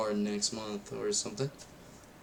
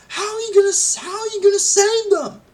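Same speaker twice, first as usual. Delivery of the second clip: much higher and louder, yelling the question; shrill disbelieving shout; 9 dB louder, faster than usual. Retold or imitated. Kid reliving the film, shouting at the screen in disbelief. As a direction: yell it up high, as if the screen can hear you. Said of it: "I'm like".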